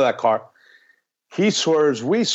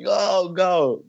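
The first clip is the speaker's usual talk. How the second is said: urgent yell; 8 semitones higher than usual. Retold or imitated. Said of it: "he was like".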